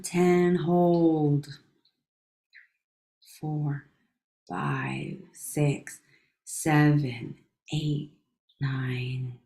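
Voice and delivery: slow, hushed counting, trailing into whispers; guided breathing count. Acted.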